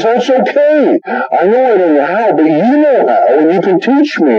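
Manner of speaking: high-pitched, eager reassurance; eager husband. Retold or imitated. Husband eagerly reassures wife in retold sitcom.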